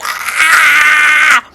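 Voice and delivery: long, high wail; sustained high cry of disgust; disgusted scream. Acted. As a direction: let out a drawn-out scream of revulsion. Screams at thought of roaches.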